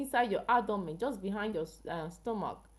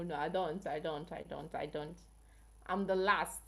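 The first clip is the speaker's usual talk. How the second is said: normal talking voice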